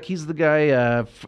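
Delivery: usual speaking voice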